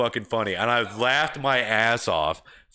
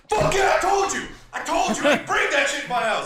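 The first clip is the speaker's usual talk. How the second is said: gloating yell. Retold or imitated. Gamer gloating across the table, triumphant yell. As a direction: rub the win in their faces. Yelling at other team.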